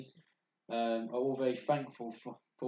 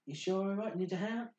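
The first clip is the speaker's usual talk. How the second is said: concerned pony; 8 semitones higher than usual. Acted. Pony checks on woken friend.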